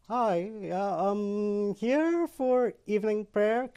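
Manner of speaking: hesitant, mock-frightened hello; acting out terrified. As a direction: creep slowly through a nervous greeting. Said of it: "I'm terrified, terrified".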